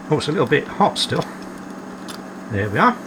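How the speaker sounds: normal talking voice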